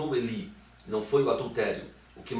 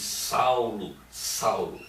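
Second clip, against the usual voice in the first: ordinary talk, nothing marked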